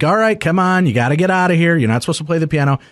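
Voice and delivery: mock scolding call to a room of partygoers. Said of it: "I'm like".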